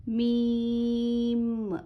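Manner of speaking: "मीम" very slow, the m hummed long and doubled; double meem. Shown as a demonstration. Teacher demonstrating the long humming meem to her pupils. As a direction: hold the m of "मीम" as if it were two. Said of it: "मीम में double मीम की आवाज़ आती".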